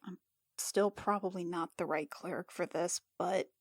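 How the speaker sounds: low mutter, half whispered; muttering to herself. Acted. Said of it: "Jill kind of mutters to herself".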